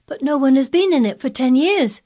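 put-on high, loud boyish protest; crying out. A narrator's reading. Colin disputing the gardener's visit, crying out. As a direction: cry it out in disbelief. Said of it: "cried Colin".

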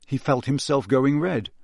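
plain reading voice

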